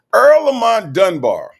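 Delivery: loud, high, drawn-out name; emphatic call-out. Real, not acted. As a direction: belt out the name slowly.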